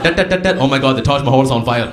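mock Indian accent, dat dat dat like Morse code, loud and laughing; a joking Indian telegraph voice. Comic spoofing an Indian accent as a telegraph message. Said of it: "that's how we send messages in India".

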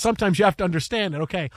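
usual speaking voice

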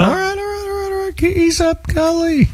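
high, hammed-up "all right, all right"; mock backing off. Host backing off from a caller talking about his mentor, in a high mock voice. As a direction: pretend to be chastened, up in a squeaky voice.

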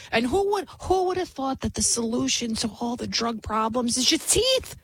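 ordinary delivery

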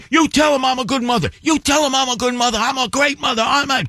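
mimicked angry mother. The mother ordering her son to praise her, high frantic imitation. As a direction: rattle it off like a mother possessed.

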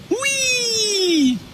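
sudden high mock squeal of glee; high mock whoop of delight; mocking whee. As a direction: squeal it like a giddy kid, mocking. Mocking Mayor Pete's fake burly image.

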